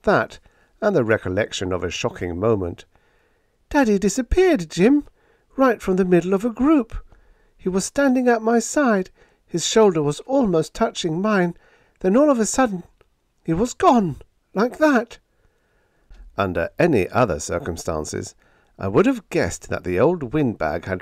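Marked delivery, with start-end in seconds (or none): 3.7-15.2 put-on high, louder anxious young woman; Helen's anxious voice. A narrator's reading. Helen telling Jim how her father vanished, anxious. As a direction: tell it with rising anxiety. Helen recounts shocking moment.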